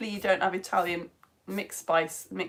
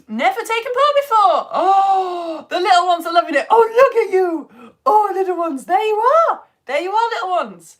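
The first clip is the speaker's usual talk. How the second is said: excited cooing; 10 semitones higher, 10 dB louder than usual. Real, not acted. Teacher greeting little ones watching live, excited cooing. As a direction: gush loudly in a high coo.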